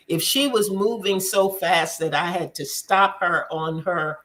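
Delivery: ordinary delivery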